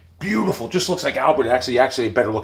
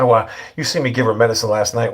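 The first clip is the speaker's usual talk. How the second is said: ordinary talk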